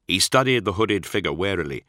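plain reading voice

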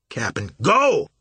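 loud shouted order. A narrator's reading. Captain shouting his crew off the steamer, loud. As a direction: bark it out, red-faced. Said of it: "shouted Marsh".

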